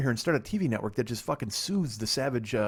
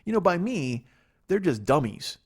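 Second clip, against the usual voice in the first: normal talking voice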